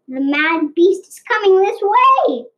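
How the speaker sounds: frightened tiger. A narrator's reading. Frightened tiger warns the girls.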